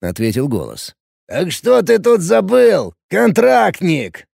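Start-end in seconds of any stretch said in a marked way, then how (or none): 1.3-4.3 slow, loud interrogating radio voice; police radio. Acted. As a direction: drag it out suspiciously over radio. Guard over radio.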